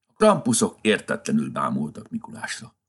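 usual speaking voice

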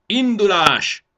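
high, drawn-out military order; commander's long, ringing shout; shouted command. A narrator's reading. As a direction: bellow the marching order slowly and loudly. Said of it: "adta ki a parancsot".

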